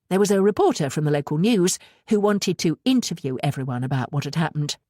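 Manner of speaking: ordinary delivery, nothing marked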